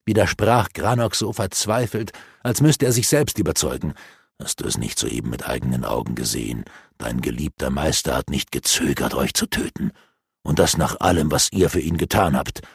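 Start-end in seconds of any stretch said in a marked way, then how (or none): 4.4-12.6 lower, sneering villain voice; dark elf mocking the captured apprentices; gloating dark elf. A narrator's reading. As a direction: taunt them with malice.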